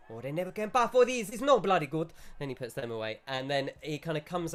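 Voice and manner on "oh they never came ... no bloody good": louder, higher, fast mock Italian shopkeeper; shopkeeper accent. Shopkeeper brushes off customer, louder fast mock Italian accent. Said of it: "And he goes".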